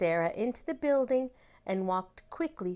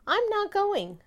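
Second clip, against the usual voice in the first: put-on high, pouty little girl voice; a child digging in her heels; stubborn child refusal; 13 semitones higher, 5 dB louder than usual. A narrator's reading. Sarah refuses her father from under the covers, high and pouty.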